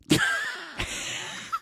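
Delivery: squeaky unvoiced giggle; high breathy laugh. Real, not acted. Laughing at awkward idea.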